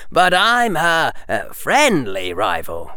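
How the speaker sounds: slow, smug with an audible grin; evil grin. Acted. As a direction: grin wickedly through it. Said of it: "with an evil grin".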